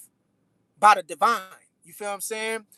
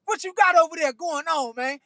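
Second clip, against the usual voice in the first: higher, teasing voice of friends asking; higher mock-nosy bruh voice; mock incredulous friends; 6 semitones higher than usual. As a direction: play the suspicious buddy poking fun. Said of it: "y'all be like".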